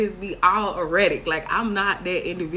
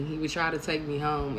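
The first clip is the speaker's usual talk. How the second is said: ordinary delivery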